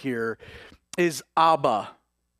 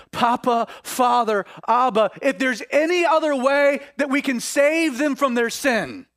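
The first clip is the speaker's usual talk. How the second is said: raised, high-pitched impassioned prayer; urgent pleading; 6 semitones higher, 4 dB louder than usual. Acted. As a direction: cry out to a father, begging for another way. Acts Jesus pleading with his Father.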